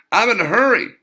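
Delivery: high, loud, insistent repeat; impatient rude woman. A narrator's reading. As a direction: insist loudly she's in a hurry. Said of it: "she repeated".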